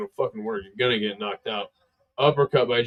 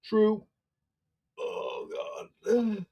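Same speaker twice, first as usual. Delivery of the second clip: ordinary delivery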